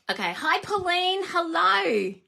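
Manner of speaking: a lilting, lifted welcome to a viewer; high greeting. Real, not acted. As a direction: greet her like a happy surprise. Greeting a viewer on live stream.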